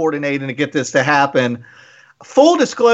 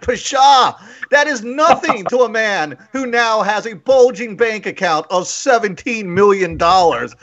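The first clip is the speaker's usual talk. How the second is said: higher-pitched, mock-indignant bluster; imitating accountant's tirade; 5 semitones higher than usual. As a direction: scoff grandly like a pompous money man. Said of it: "you just went on this whole tirade".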